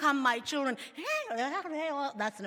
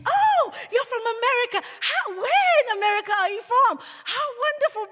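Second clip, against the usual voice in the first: high and loud gushing welcome, much higher; gushing shop clerk; 12 semitones higher, 10 dB louder, faster than usual. Retold or imitated. Shopper hearing an American accent, gushing loudly up high. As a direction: act out a clerk falling over herself with delight. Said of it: "they would".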